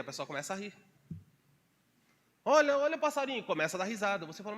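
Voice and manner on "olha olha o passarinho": high, loud, giddy gushing at a bird; giddy delight. Retold or imitated. Preacher mimics a person who laughs at everything, giddy high squeal.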